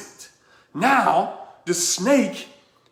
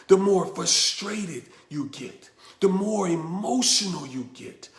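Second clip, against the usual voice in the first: ordinary talk, nothing marked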